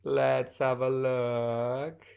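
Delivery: low, soft, very slow musing; drawn-out pondering. Real, not acted. Host examining a player's answer, drawn-out pondering. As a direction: stretch it out slowly and quietly while checking.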